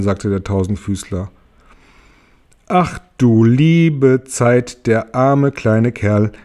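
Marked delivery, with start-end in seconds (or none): 2.7-6.3 langsam und gedehnt, mitfühlend; Marienkäfer bedauert James, sehr gedehnt; mitleidiger Ausruf. Acted. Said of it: "rief der Marienkäfer". (langsam gedehnter mitleidiger Ausruf)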